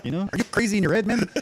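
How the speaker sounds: high mock French Canadian accent. Riffing on a fighter's taunts, high French Canadian impression. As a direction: challenge them in a hammed-up French Canadian accent. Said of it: "G S P accent".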